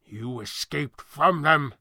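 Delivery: grind it out slowly, fuming. Acted. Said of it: "Thatcher fumed".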